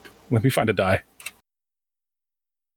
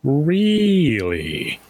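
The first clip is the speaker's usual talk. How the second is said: higher, impressed, courteous gentleman; intrigued gentleman merchant; 6 semitones higher, slower than usual. Acted. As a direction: react with drawn-out, refined interest. Calder reacts to the firm's name.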